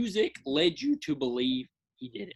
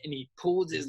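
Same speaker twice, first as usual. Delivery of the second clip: normal talking voice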